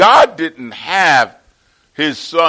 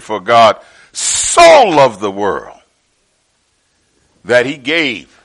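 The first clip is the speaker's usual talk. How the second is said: slow and deliberate, each word given weight; slow, weighty reading; 2 semitones lower, 4 dB louder than usual. Shown as a demonstration. Preacher reading the verse to his church, slow and deliberate. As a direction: let every word of the verse land. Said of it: "not meant to be read over kind of fast".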